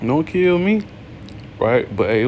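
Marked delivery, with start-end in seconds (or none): 0.1-0.8 much higher, mock begging for his life; panicked son. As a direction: imitate the terrified boy pleading. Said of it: "he was probably freaking out".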